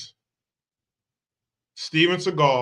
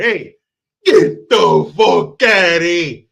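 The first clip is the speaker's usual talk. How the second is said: loud, drawn-out bad Italian accent; 3 semitones higher, 10 dB louder, slower than usual. Comic clearing a room, bad Italian accent yell. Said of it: "do that Italian accent".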